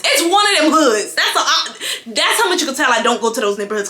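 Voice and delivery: ordinary talk, nothing marked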